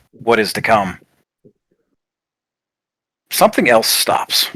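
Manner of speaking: game master narration with long pauses; suspenseful scene narration. Acted. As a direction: let each halted moment hang in silence.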